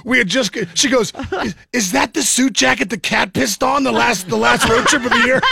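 can barely get through it for laughing; laughing through the story. Real, not acted. Telling the podcast hosts about the cat, laughing.

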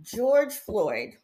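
normal talking voice

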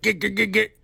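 stuttering cartoon plumber gibberish; old Mario voice. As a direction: mimic the rejected plumber voice. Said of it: "Rather than talking like".

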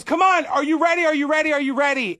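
put-on high, shouted kid urging; excited little girl. Girl pushing the dad to get ready, high excited shout. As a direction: hurry him up like an impatient kid.

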